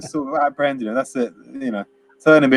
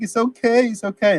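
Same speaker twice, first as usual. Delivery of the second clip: higher, hammed-up soothing; mock Denny; 8 semitones higher than usual. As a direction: ham up the naive kid brushing it off. Imitating Denny calming them.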